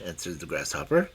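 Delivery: ordinary delivery, nothing marked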